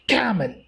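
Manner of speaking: camel as CAM-el, first syllable stressed. Shown as a demonstration. Teacher showing students the correct word, camel stressed on CAM-. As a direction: hit CA in "camel", let -mel drop. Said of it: "Ka should be present".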